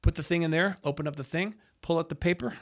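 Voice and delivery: usual speaking voice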